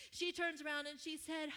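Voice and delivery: ordinary talk, nothing marked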